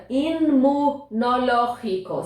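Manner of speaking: "Imunológico" slowly, with a Spanish j sound; Spanish pronunciation. Shown as a demonstration. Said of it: "Olha a combinação aí". ("Imunológico" devagar, com som de jota espanhol)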